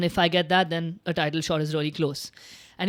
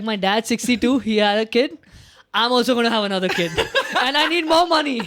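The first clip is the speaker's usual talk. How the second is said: loud, higher imitation that breaks into laughter; excited fighter quote; 4 semitones higher, 4 dB louder than usual. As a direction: quote the fighter's brag while cracking up. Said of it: "He's like".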